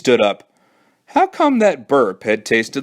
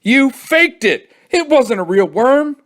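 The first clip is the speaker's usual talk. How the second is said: panting accusation; 5 dB louder than usual. Acted. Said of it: "panted Billy".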